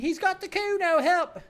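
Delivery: put-on very high, loud screaming kid; child shouting for help. Child shouting for help at the windows, screaming. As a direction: scream to the whole block. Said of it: "The boy joins in".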